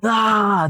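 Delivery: high mock scream; acted scream. The daughter screams seeing her mother, high shriek. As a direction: let out a sharp high shriek. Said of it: "there's a scream".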